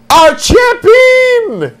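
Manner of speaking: long drawn-out high announcer shout; announcer hype. Acted. Host hyping the show's final champion, big announcer shout. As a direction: belt it like a ring announcer.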